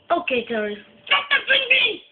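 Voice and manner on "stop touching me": high-pitched comic screech; shrill hammed-up protest at being touched; indignant outburst. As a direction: squawk it in sudden mock outrage.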